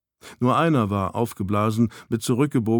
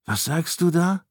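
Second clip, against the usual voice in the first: higher, bewildered question; dazed stammer; 6 semitones higher than usual. A narrator's reading. Stunned narrator to Snow, stammering dazedly. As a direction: ask it in a befuddled daze. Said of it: "stammelte ich ganz benebelt".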